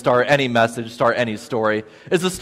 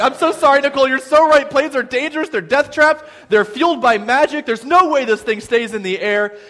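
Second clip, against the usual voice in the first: high-pitched, loud mock panic; panicked babble; 8 semitones higher, 3 dB louder than usual. Panicking passenger apologising to the friend he teased, frantic. Retold panic on a smoking plane.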